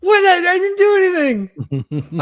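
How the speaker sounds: protest. Acted. Huxley protests to the guard.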